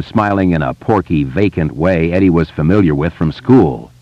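usual speaking voice

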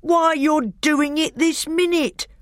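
high, slow, indignant bellow. A narrator's reading. Trumpkin catches the bear sucking his paw, bellowed. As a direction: holler it in outrage, drawing the words out. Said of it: "bellowed Trumpkin".

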